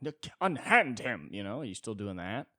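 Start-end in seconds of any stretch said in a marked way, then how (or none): 0.0-1.3 slow, loud, higher scandalised cry; mock shocked rich lady; pearl-clutching lady. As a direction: gasp it out like a prim dowager. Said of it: "clutching pearls".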